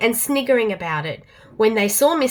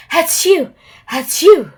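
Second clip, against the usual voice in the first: louder fake sneezes; mock sneezing; 6 semitones higher, 8 dB louder, slower than usual. Pig insists nothing sneezes, with mock sneezes. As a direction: sneeze it out twice. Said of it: "fat and pink and goes".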